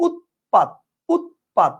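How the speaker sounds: deliberate syllable-by-syllable "উৎপাত"; pronunciation demo. Shown as a demonstration. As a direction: break "উৎপাত" after the first t and punch "পাত". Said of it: "উচ্চারণ কিন্তু আপনি দুইটাতেই same করতেছেন".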